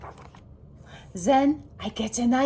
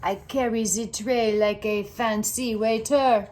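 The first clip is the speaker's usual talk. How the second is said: ordinary delivery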